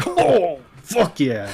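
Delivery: high whoop of delight into a laugh; cheer, then burst out laughing; excited cheer. Real, not acted. Rolling a natural twenty.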